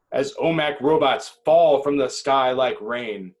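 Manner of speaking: usual speaking voice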